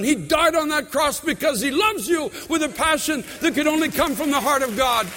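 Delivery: sermon climax, fervent; passionate preaching. Real, not acted. Preaching builds.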